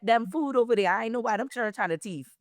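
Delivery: a sentence in Gullah Geechee dialect, "thief" as a verb. Shown as a demonstration. Giving the host an example sentence, in Gullah Geechee dialect. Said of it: "We say thief".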